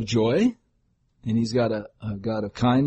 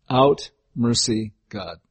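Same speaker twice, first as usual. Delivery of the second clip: ordinary delivery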